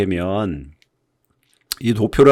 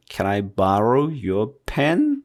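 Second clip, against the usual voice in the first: Can I borrow your pen said very slowly; very slow drill; much slower than usual. Shown as a demonstration. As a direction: spell out each word, slow. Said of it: "소리를 만드는 연습". (아주 천천히 연습하는 문장)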